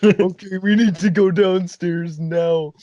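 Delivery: high, drawn-out urgent plea; mock stoned urgency. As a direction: imitate a very high friend, stretching it out. Stoned friend urges going downstairs.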